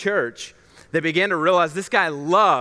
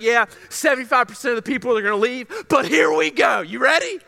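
high-pitched, rallying hype; super fired up; 6 semitones higher, faster than usual. Retold or imitated. Leader rallying his young pastor for change, super fired up. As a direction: imitate a pumped-up leader building to a shout. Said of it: "Super fired up".

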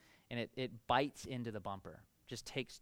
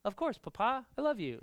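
mock sweet reply; 8 semitones higher, 4 dB louder than usual. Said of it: "what was my response".

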